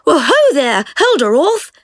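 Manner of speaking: breezy shout to the other boat; cried out. Acted. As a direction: hail them cheerfully from the boat. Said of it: "Jemima cried".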